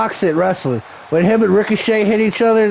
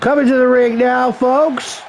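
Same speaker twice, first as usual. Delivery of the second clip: higher-pitched ring announcer call; 6 semitones higher, slower than usual. Pretend. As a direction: announce the wrestler to the crowd.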